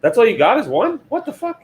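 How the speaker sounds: shocked disbelief, pitch jumping way up; high squeal of disbelief; surprised. Real, not acted. Shocked he owns one printer.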